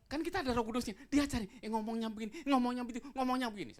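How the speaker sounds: high, loud pulpit exhortation; full-force preaching. Acted. (berkhotbah lantang dan berapi-api)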